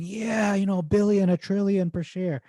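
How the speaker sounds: put-on high-pitched, eager inner child; inner kid. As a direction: pitch it up like a kid dreaming big. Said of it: "the kid inside of me is going".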